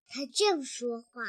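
ordinary delivery

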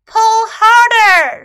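high, very loud, drawn-out urging; shouting. A narrator's reading. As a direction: shout it long, straining for effort. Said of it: "shouted Snake".

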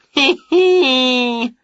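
high smug tittering; gleeful snicker. A narrator's reading. Wanda snickering at Wilbur's loss, high. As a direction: snicker at the rival. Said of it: "said Wanda".

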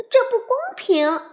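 put-on high, loud child protest. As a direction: cry out that it isn't fair. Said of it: "小熊妹妹抗议说". (高声抗议)

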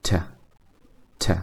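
"t" as a short tuh with schwa; weak form. Shown as a demonstration. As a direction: say a soft, brief "t" plus uh. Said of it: "在口语中经常会被弱读为".

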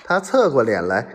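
ordinary delivery, nothing marked

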